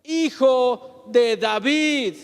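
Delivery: high, loud, slow plea, drawn out; crying out. Retold or imitated. Blind Bartimeo crying out to passing Jesus, loud slow plea. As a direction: call to Jesus across the crowd, stretching every word. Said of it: "a gritar es".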